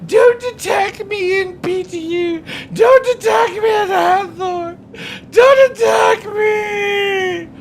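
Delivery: high, loud, wailing pleas; mocking whiny player.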